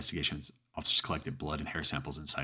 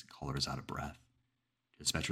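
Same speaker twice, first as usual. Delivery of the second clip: plain reading voice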